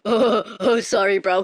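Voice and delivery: loud mocking chuckle-voice; imitating dopey crew. Boat crew apologizing to bleeding rider, mocking imitation. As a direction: mimic a laughing, careless apology. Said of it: "They're like".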